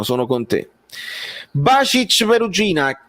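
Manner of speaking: ordinary talk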